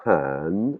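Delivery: HAN swept up and back down; broken Thai tone on "han"; rising-falling tone. Shown as a demonstration. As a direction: glide up then down through a long "han". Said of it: "c'est l'intonation qui monte et qui descend".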